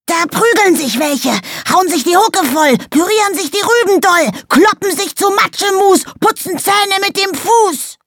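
hoch, schrill und aufgeregt; Koboldstimme. Acted. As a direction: quirliger kleiner Kobold, ganz aufgedreht. Kobold Fitz spricht. (aufgeregte, hohe Koboldstimme)

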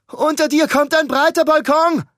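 loud high call up to the roof. Acted. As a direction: shout it up to someone far above. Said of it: "rief Ruben hinauf". (laut nach oben gerufen)

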